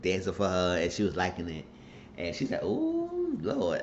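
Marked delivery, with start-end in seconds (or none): 2.6-3.8 high falsetto "Ooh, Lord"; mock swooning. Veronica's reaction to the dance.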